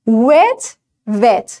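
"Wet" said with rounded lips, no V; careful model of wet; contrasting W. Shown as a demonstration. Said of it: "فرض کن این تلفظ رو اشتباه بگی". (تلفظ درست wet)

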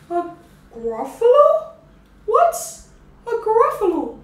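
high, loud owl voice; startled owl. Acted. Owl asking the mouse, high and startled. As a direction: owl hooting in alarm. Owl asks about the Gruffalo.

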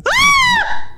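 sharp high scream of fright; startled scream. Real, not acted. Jump scare hits the gamer in the face, high scream. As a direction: yelp as it leaps at you.

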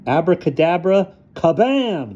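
high, dramatic magic words; magician's incantation. A narrator's reading. Ludwig makes the apple explode, crying the spell. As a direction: call out the spell with a flourish.

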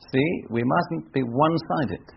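normal talking voice

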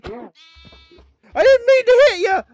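high, loud, drawn-out yell; frantic apology. Real, not acted. Accidentally hit a cow.

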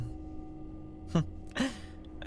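breathy quiet chuckle under the breath; soft laugh. Acted. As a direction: laugh softly, amused. Scientist amused at subject.

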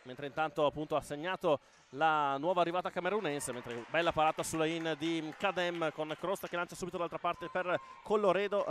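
fast, loud live play-by-play; breathless italian goal commentary. Acted. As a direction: call the action as it happens.

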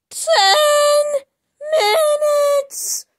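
very high, slow squeaky time card announcement; squeaky announcer voice. Acted. Narrator announcing the time skip in a very high squeaky voice. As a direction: stretch it out like a cartoon title card.

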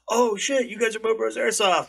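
high-pitched, thrilled recognition; excited fan. Retold or imitated. Fan recognizing the Mo Bros team, excited. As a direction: imitate a starstruck fan spotting the team. Retelling fans recognizing them.

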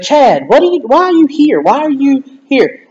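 imitated exasperated mother, pitch swooping high; high-pitched mock exasperation, dragging out the last question; frustrated mom. Mom tripping over her clinging child in the kitchen, acted frustration. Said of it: "finally she got frustrated".

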